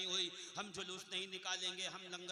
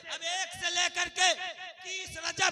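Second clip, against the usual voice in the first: loud, high, defiant preacher cry; preaching at full force; 8 semitones higher, 20 dB louder than usual. Acted. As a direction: build to a ringing climax. Preacher climbs to climax.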